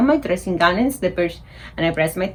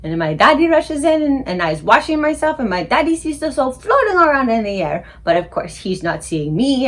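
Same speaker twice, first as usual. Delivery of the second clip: plain reading voice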